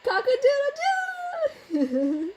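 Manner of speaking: high, slow cock-a-doodle-doo breaking into laughter; laughing rooster crow. Pretend. Mom crowing back at her toddler, high and laughing. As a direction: crow like a rooster while cracking up.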